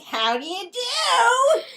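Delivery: high, slow squeaky toy voice laughing; a cracking-up, high, drawn-out toy voice; pacifier voice. Acted. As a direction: play a silly talking pacifier. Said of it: "Stop talking like the pacifier".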